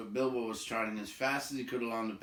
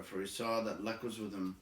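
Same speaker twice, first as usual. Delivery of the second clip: ordinary delivery, nothing marked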